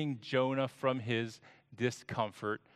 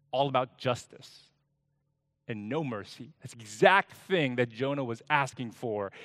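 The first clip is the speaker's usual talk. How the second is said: usual speaking voice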